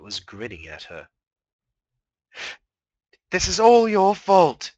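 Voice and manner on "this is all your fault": slow, loud, high accusation; crying out in rage. Acted. Mare shouting at her sister's moon, furious. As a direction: fling the blame at the moon. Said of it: "she cried".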